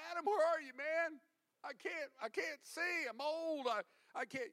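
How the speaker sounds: put-on high, loud, feeble old man bit; mock confused old God. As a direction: pretend God can't see, hammed-up. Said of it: "He didn't say to Adam in the garden".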